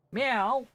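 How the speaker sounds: very high mock meow; squeaky meow imitation; cat meow. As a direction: mew to call the cat. Calling the cat.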